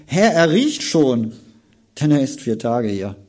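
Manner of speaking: she objects, it already stinks; Martha protesting. Acted.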